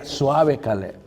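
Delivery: put-on calm a boy down tenderly; gentle wife. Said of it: "mi esposa siempre le decía".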